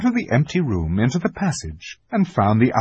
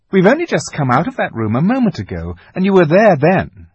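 loud, impatient sister; scolding older sister; 6 semitones higher, 9 dB louder than usual. A narrator's reading. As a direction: brush off the little one. Susan voiced in story.

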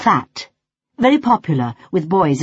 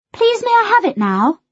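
put-on high, polite little girl voice; 14 semitones higher, 3 dB louder than usual. A narrator's reading. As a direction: ask sweetly, pitched high. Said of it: "she asked politely".

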